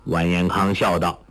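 ordinary delivery

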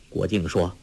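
usual speaking voice

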